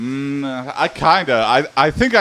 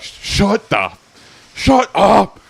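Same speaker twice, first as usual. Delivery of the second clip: annoyed snap; 7 semitones higher, much slower than usual. Retold or imitated. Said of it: "It's like".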